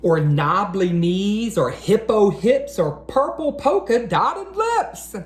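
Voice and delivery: lively rhyming picture-book read-aloud; reading to children. Acted. Teacher reading a rhyming book to boys and girls, storytime read-aloud. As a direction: read each rhyme out to a room of young kids.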